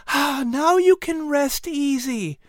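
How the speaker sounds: high, slow, gentle mother voice; soothing. A narrator's reading. Mother calming her son, soothing. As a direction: soothe him to rest. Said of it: "Sarah said soothingly".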